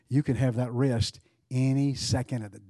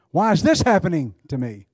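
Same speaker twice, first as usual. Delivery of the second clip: THIS punched loud and high, then falling away; stress on THIS; 4 semitones higher, 8 dB louder than usual. Shown as a demonstration. Preacher showing the church different phrasings, stress on "this". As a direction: lean hard on the word this. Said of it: "Depending on where you emphasize the word".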